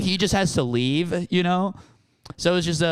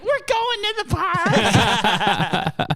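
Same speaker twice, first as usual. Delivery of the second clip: very high, drawn-out mock squeal; hammed-up helpless laughing wail; imitated crying-laughing mom; much slower than usual. Mom with her son facing the cops, imitated crying laughter. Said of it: "crying, laughing".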